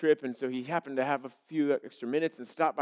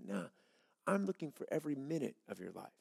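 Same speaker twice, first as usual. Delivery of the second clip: much softer, calm God voice; God answering; 2 semitones lower, 9 dB quieter than usual. Acted. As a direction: gently turn down the offer as God. God's voice answering.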